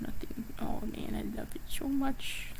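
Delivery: soft breathy whisper to herself. Real, not acted. Artist whispering to herself while admiring her characters, hushed whisper. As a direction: murmur it under your breath, gushing quietly.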